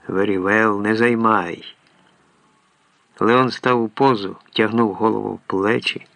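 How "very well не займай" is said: plain reading voice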